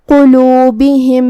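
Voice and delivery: قلوبہم said slowly with a heavy qaf. Shown as a demonstration. Teacher demonstrates the heavy qaf slowly. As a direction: draw out قلوبہم, starting on a thick qaf. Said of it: "ہمیں اسے تفخیم سے ادا کرنا ہے".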